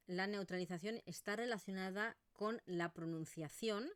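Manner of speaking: ordinary talk, nothing marked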